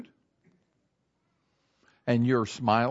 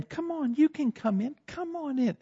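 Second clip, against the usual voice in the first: high, light invitation to another driver; beckoning; 12 semitones higher, 5 dB quieter than usual. Acted. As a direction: wave someone in with a high-pitched voice. Waving a driver into traffic.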